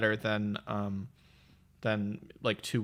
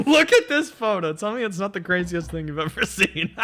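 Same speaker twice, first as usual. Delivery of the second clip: laughing; 9 semitones higher, 7 dB louder than usual. Real, not acted. Laughing at her own photo.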